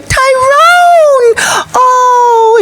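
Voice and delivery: very high, drawn-out delighted squeal; hammed-up thrilled cry; excited. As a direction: imitate a woman squealing a joyful greeting. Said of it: "and she's all excited".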